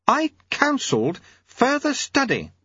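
high, slow ladyship voice; high-pitched formal protest; Lady Lejeune. Acted. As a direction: insist in a high, deliberate lady's voice. Lady Lejeune argues with auditor.

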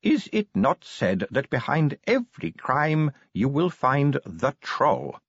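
high, aged dwarf slowing to the end; thin old voice. A narrator's reading. Old dwarf invoking a saying against trolls, slowing. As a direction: draw out the final words as an old elder. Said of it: "said the first voice".

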